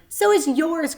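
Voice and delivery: put-on high, loud boy shouting back; cry back. A narrator's reading. Avery shouts back at Fern mid-swing, loud. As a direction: fling it back at her. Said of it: "cried Avery".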